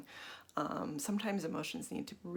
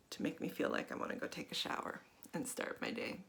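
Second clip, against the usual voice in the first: soft, halting, half-whispered anxious admissions; tapping confession; 4 dB quieter than usual. Acted. Speaker voicing an anxious viewer's feelings while tapping, softly and haltingly. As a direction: confess the anxiety quietly while tapping through it.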